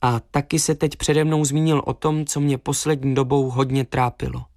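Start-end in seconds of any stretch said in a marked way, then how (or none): none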